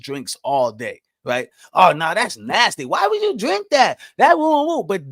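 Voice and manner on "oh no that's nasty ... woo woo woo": high, squeaky mock "eww" at a friend's drink; hammed-up grossed-out friend, pitched way up; mock disgust. Mocking someone disgusted by a drink.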